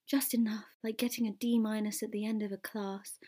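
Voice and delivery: near-whisper, softly bitter; hushed aside. Acted. Eve likens it to a D minus, hushed. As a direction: let it sink to a whisper. Acted muted hurt aside.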